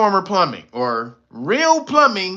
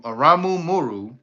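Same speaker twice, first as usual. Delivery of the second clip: ordinary delivery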